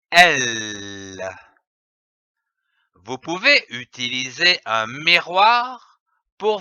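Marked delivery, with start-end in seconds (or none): 0.1-1.2 a long, steady L held on the tongue; "L." sung out as a pure held L, tongue up at the palate; held L sound. Shown as a demonstration. Teacher showing pupils the L sound, held long. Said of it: "Je prononce le son".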